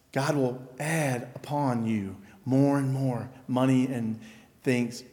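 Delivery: soft, mocking prosperity-gospel promise; lightly voiced smooth false teacher; mock prosperity preacher.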